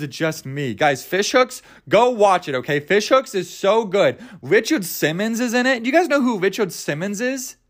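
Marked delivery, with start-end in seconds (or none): none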